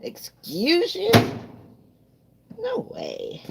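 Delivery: high, slow, sassy put-on voice; high drawn-out mock offense; sassy mock indignation. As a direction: play an offended diva. Mock sassy argument with someone.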